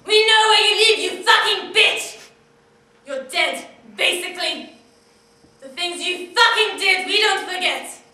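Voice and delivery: loud, snarling threat down the phone; furious threat. Acted. Caller threatening Anne on her answering machine, shouting. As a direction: make every word a menace.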